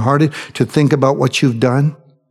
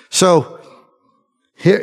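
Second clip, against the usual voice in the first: ordinary delivery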